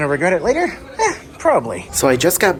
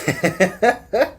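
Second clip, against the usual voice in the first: quick self-mocking laugh; short laugh; 4 semitones higher than usual. Real, not acted. Laughs at own joke.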